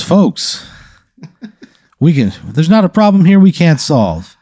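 high-pitched, showy man in charge; put-on genial boss voice; booming problem-solver. Booming newcomer takes charge.

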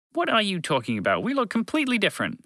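indignant alien objection; objecting creature. Acted. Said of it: "the creature objected".

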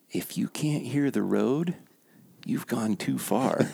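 put-on slow, hushed drunk secret; mock whisper. Drunk bum shares his secret, slow and hushed. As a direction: lean in and let it drip slowly. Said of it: "he kinda whispers".

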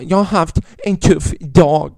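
slow, put-on voice of the offended woman; mock whiny complainer. Comedian mimicking an offended audience member, slow mock whine. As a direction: drag it out like a sulking audience member.

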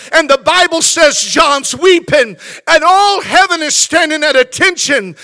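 full-force shouted preaching, high and loud. Real, not acted. Preacher tells the congregation who took the book, shouting high and loud. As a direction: cry it out with long, leaning stresses.

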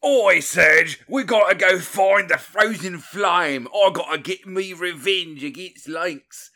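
put-on loud, high, over-the-top Australian accent; ridiculous Australian accent. Podcast host imitates Kid's accent for Serge: ridiculous Australian accent. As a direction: belt it out in a hammed-up Aussie accent. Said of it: "the most ridiculous accent".

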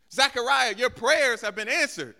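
high, very loud, drawn-out proclamation; proclaimed announcement. Acted. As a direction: herald the news at full force. Angel's announcement proclaimed loudly.

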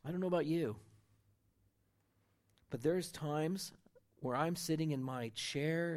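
ordinary delivery

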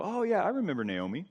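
townsperson recalling someone. Acted. Townsperson answering the gossip about Naomi, recalling her. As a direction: play a neighbour suddenly remembering. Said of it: "Imagine people scurrying about, talking".

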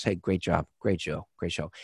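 low, soft, understated impersonation; Robert De Niro. Retold or imitated. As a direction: drop the voice and mumble quiet congratulations. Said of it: "he shakes my hand and he goes".

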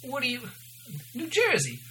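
high-pitched, searching attempt at New Jersey; hammed-up New Jersey waitress; New Jersey accent. Shown as a demonstration. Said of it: "I gotta redo that".

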